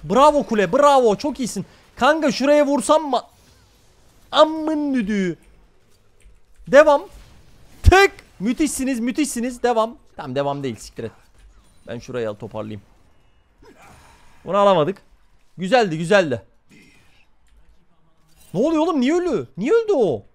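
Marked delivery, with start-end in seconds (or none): 0.0-10.0 high, loud hype calls; excited cheering. Real, not acted. Streamer cheering the tower during a fight, excited loud cheering. 18.5-20.2 high, loud disbelieving questions; shocked outburst. Real, not acted. Streamer seeing a teammate die, shocked loud questions.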